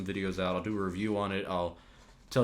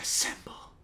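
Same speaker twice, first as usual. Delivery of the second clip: a mock hushed whisper; 4 dB quieter than usual. Captain America whispering the order, mock anticlimactic whisper. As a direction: breathe the word out with no voice. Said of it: "he whispers".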